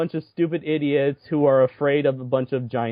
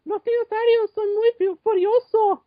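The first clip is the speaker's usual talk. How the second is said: high, put-on fake Mexican accent in Spanish; mock Mexican accent. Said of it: "accent is annoying me".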